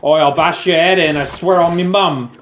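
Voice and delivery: cockney thug voice. Pretend. Jokey tough-guy threat.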